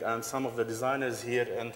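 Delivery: usual speaking voice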